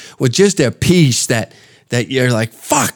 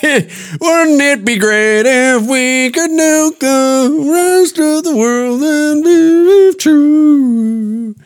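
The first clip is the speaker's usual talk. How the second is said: much higher, slow, put-on sing-song old man; mocking old veteran voice; 12 semitones higher, slower than usual. Two old men watching the trains, high slow sing-song mock reminiscence. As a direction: croon it like a doddering old soldier reminiscing. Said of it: "and think to ourselves".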